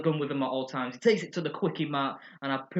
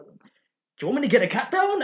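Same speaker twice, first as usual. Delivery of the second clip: eager Homer; 10 semitones higher, 7 dB louder than usual. Retold or imitated. Homer offering to shoot cat.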